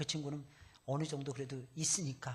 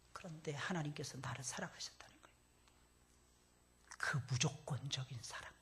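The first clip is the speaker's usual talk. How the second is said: hushed sermon whisper; 9 dB quieter than usual. Acted. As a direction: lean in and breathe it. Preacher drops to a whisper.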